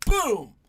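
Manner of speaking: loud mouth gunshot boom. Retold or imitated. Describing the assassination, a loud mock gunshot. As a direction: shout a gunshot sound effect.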